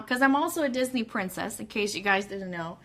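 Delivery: ordinary delivery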